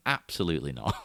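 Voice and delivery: low, final mock veto; studio refusal. Studio answering the director's idea, firm mock refusal.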